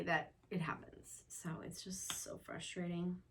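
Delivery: soft unvoiced whisper, worn out; weary whisper. Real, not acted. Tired mom confiding frustration in a whisper. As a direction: let it drain out as a whisper.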